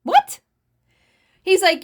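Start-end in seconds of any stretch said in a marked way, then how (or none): 0.0-0.4 high, loud shocked What; stunned outburst of disbelief. Retold or imitated. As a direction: blurt it out in disbelief. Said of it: "I was like".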